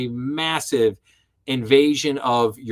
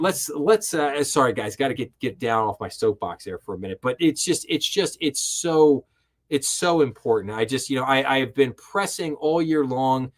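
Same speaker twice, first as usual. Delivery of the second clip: ordinary talk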